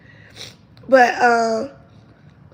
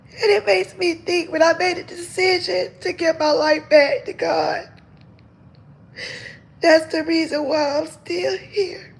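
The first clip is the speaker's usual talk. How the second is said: higher voice on the edge of crying; pushing through tears while testifying to God; real crying, words starting to break; tearful testimony; 6 semitones higher than usual. Real, not acted. Tearful testimony of giving life.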